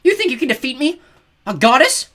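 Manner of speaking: very high, loud incredulous fury; outraged goddess. Acted. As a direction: scoff with disbelieving rage. Goddess enraged at Piper.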